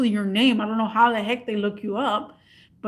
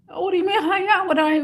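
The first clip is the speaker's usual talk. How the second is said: flustered caller; 8 semitones higher, faster than usual. Retold or imitated.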